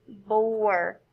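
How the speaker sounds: "Bore" joined as one word; "Bore" said whole as the final word; blended word. Shown as a demonstration. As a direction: run "B" straight into "or" as "Bore". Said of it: "say our final word".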